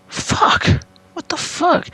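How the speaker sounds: mostly whispered, alarmed cursing; retold curse under the breath, squeaking up; whispered panic. Scared reaction to teacher.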